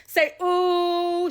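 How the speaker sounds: high, loud eager prompt; urging a child to sing the note; coaxing mother. Retold or imitated. Mother coaching her daughter to sing, high and eager. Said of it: "she's telling my sister".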